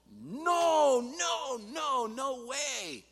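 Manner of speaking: loud, high, drawn-out no's; emphatic refusal. Acted. Preacher voicing Paul's refusal to congregation, high loud slow no's. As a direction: thunder the no's, slowing at the end. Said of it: "And Paul says".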